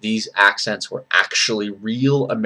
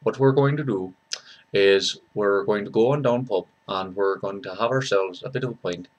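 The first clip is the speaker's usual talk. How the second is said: soft, lower Dublin accent; Irish accent; 3 semitones lower, 6 dB quieter than usual. Shown as a demonstration. Dubliner planning a pint at the pub, Irish accent. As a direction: do a rough Dublin lilt, quietly.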